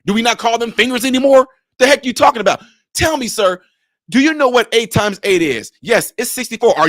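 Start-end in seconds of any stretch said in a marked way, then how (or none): none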